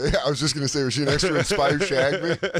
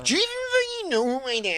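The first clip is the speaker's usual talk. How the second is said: high-pitched mock rich-kid indignation; entitled taunt; 12 semitones higher, much faster than usual. Rich kid pulling rank on a stranger, entitled taunt.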